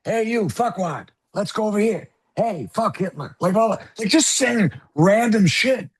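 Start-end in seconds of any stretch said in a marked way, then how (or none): 0.1-3.3 a hint of Jimmy Stewart, pitched higher; hammed-up old-movie hero, barely put on; terrible Jimmy Stewart impression. Mocking the actor's monologue at the table with a hint of Jimmy Stewart. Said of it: "terrible Jimmy Stewart impersonation".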